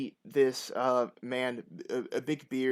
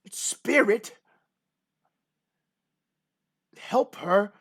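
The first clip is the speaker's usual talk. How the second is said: high, loud, incredulous questions; startled disbelief; 9 semitones higher, 8 dB louder, much slower than usual. Acted. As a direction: echo it back in shock. Farmer startled by players' claim.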